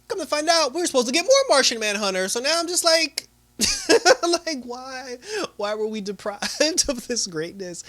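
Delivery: high-pitched, laughing fan protest; worked up, voice riding high; giddy indignation. Real, not acted. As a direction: complain excitedly through laughter. Fan laughing over a missed character.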